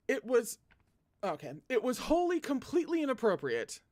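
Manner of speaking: loud, high flustered protest; flustered outburst. Real, not acted. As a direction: blurt it out, embarrassed. Flustered loud reaction to text.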